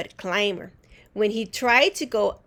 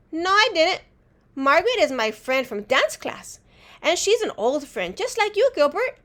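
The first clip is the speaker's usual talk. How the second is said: put-on girl voice; 7 semitones higher, 4 dB louder than usual. A narrator's reading. Girl corrects friend.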